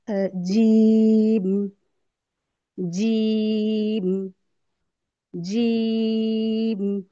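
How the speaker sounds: जीम stretched very long, very slow; drawn-out jeem. Shown as a demonstration. Teacher drills long letter names for students, very slow stretched recitation. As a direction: hold the ई of जीम a long time. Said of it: "खींच कर लंबा करके पढ़ रहे हैं".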